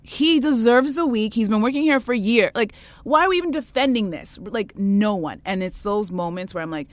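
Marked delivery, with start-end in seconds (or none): 0.0-4.4 higher, louder, outraged telling-off; imagined indignant rebuke to the boss; indignant confrontation. Retold or imitated. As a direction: finally stand up to the boss. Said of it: "Not one of us said to her".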